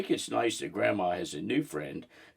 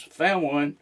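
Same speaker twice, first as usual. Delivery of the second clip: mild yell; 6 semitones higher, 4 dB louder than usual. A narrator's reading. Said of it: "Micah yells".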